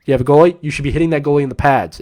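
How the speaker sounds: usual speaking voice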